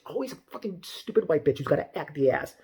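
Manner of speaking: usual speaking voice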